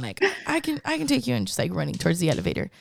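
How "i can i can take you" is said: put-on eager old man.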